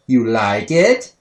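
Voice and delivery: slow, drawn-out question rising at the end; eerie little-kid query; toddler catchphrase. Retold or imitated. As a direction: stretch it out like a creepy toddler. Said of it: "looked him right in the eye and asked".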